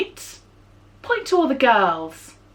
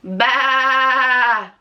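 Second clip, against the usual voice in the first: sheep bleat. Shown as a demonstration. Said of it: "That's right".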